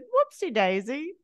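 a hint of a posh Englishman; replaying his line for a laugh; imitating Hugh Grant again. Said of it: "He's like".

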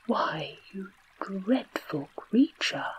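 mildly scandalised tiger voice; reproachful. A narrator's reading. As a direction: scold with a touch of hurt indignation. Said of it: "said the tiger reproachfully".

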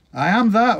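put-on old John shouts back from the hill; shouted. A narrator's reading. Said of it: "old John shouted".

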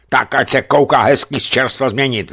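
scornful officer's retort; angry retort. A narrator's reading. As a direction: bite back at him. Bennett scolding.

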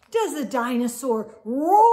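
loud playful roar-like read. Acted. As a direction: boom it out like a dinosaur. Reading the roaring dinosaur page.

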